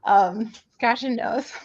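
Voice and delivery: normal talking voice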